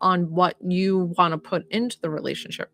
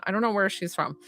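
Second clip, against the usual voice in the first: usual speaking voice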